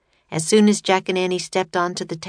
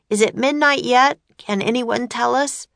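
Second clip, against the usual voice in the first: yelling; 6 semitones higher, 3 dB louder than usual. A narrator's reading. Jack yelling to the crowd.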